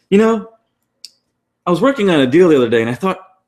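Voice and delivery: high, fast, eager retelling of a friend; bright rushed telling of his deal story; excited quote. Retold or imitated. Friend retelling his deal, high and quick. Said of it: "And he's like".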